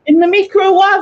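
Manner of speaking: loud, high mock "microwave" as "meekro-wah-vay"; imitating odd pronunciation. Friend mocking a TV cook's word, "microwave" as "meekro-wah-vay". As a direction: mimic "microwave" as four parts, "mee-kro-wah-vay", incredulously. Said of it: "listen to the way Nigella says microwave".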